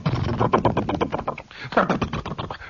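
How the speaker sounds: rapid fluttering mouth noise; imitated cone flutter. Storyteller shows how the big speaker cone jiggled, fluttering noise. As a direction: rattle like a speaker cone on a bass note. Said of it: "that cone would just go".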